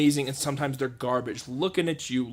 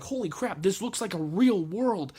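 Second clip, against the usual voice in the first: amazed; 6 semitones higher than usual. Retold or imitated. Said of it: "I was like".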